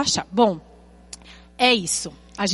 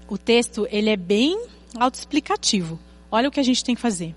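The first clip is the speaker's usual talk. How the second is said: ordinary talk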